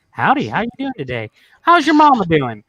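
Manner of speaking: folksy small-town Texan welcome; friendly Texan greeting. Retold or imitated. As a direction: greet like a laid-back Texan. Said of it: "laid back towns, and they're like".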